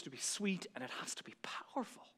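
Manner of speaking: whispered prayer. Shown as a demonstration. Preacher praying for his witness, whispered. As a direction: drop to a hushed prayer.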